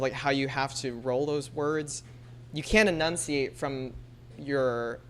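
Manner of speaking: normal talking voice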